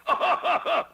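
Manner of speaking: a high, loud scornful laugh; mocking belly laugh. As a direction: laugh the puppet's hope to scorn. Stromboli mocks Pinocchio's plan.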